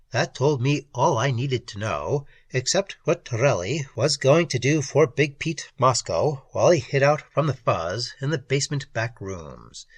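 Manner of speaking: usual speaking voice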